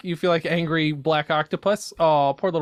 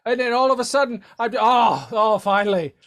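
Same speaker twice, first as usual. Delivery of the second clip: a time traveller's mind clearing after the trip; dazed old-timey caller coming round; 6 semitones higher than usual. Acted. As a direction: drift out of a daze into relief.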